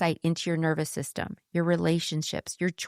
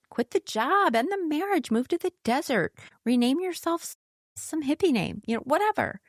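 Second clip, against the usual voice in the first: much higher, breezy mock enthusiasm; mocking list; 9 semitones higher than usual. Teacher lampooning a spiritual myth, high and mocking. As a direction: rattle off the myth like a caricature.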